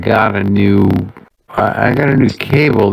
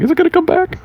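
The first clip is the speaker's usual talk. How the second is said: much higher, worried squeak; imitating his own panic, pitched way up; high panicked voice; much faster than usual. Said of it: "I'm like".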